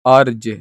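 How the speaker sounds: अर्ज said loudly, with a half r flowing straight into ज; teacher calls out अर्ज, stressing the half r; half r sound. Shown as a demonstration. Teacher shows the class how अर्ज sounds, loud and clear. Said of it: "र आधा उच्चारण लग रहा है".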